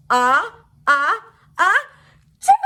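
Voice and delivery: mock sneeze into the elbow; demo elbow sneeze. Shown as a demonstration. Showing her friend the proper way to sneeze, mock sneeze. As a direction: show a muffled pretend sneeze. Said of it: "like this".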